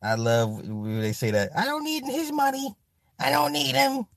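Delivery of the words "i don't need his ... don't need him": mocking high girly voice. Mocking women's claims.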